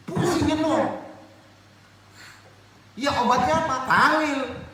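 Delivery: high, loud, ringing challenge to the congregation; forceful preaching. Real, not acted. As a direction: fire questions at them at full voice.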